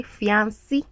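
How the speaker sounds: fiancé said as fee-AN-see; common mispronunciation. Shown as a demonstration. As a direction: end fiancé on a "see" sound. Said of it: "there are people that say".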